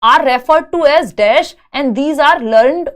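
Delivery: slow, deliberate read-aloud of an exam question. Acted. Teacher reading a test question to students, slow and deliberate. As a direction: read it out word by word for the class.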